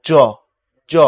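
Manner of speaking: জ said as "jo", each one separate; pressed j. Shown as a demonstration. Teacher contrasting the other letter, জ as j. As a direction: press জ into a hard j. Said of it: "আর ওইটার মূল উচ্চারণ হচ্ছে".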